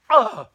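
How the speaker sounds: sudden loud, high choked breath; one abrupt dying gasp; imitated last gasp. Storyteller acting out the cat expiring, loud final gasp.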